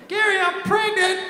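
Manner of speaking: high-pitched woman's voice, slow; high girl voice. Acted. Caller to Gary, high put-on woman's voice. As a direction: play the girl on the phone, high and breathy-free.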